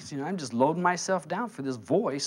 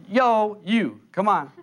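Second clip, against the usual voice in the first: loud, higher-pitched hail; calling out; 5 semitones higher, 6 dB louder, slower than usual. Acted. God summoning the chosen, calling out loudly. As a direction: shout it like summoning someone from afar. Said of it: "if you were called of God, he goes".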